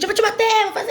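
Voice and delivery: high-pitched imitation of a frazzled parent threatening; mock angry threat. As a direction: act out a mother losing control. Mimics a parent threatening the children.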